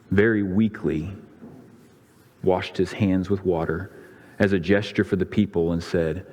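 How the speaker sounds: ordinary talk, nothing marked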